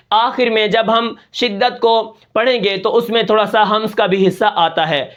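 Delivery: ordinary delivery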